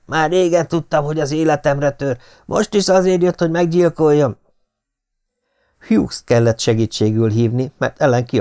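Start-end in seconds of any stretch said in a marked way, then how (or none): 0.1-4.4 high, loud, frantic accusation; screeching accusation. A narrator's reading. Feverish Ellen accusing the secretary, screeching. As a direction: shriek the accusation at him. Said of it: "rikácsolta".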